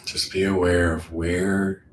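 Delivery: calm meditation voice with long, settling pauses; guided meditation. Acted. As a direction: guide them inward, unhurried.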